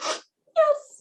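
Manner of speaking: tiny squeaked, weepy acceptance; mock tearful. Girlfriend accepting a proposal mid-cry, mock tearful. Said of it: "very, very ugly cry".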